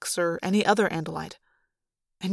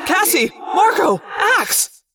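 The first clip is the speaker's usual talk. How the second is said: high, panicked calling out; yelling for friends; 10 semitones higher, 4 dB louder, much slower than usual. A narrator's reading. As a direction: shout each name urgently. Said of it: "I yelled".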